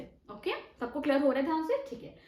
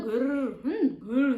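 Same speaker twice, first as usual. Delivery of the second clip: loud playful growl; lion growl imitation; 6 dB louder, much slower than usual. Shown as a demonstration. Teacher growls like a lion, mock scary. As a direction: growl like a lion to scare kids. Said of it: "ऐसे करके ना डरा देता है".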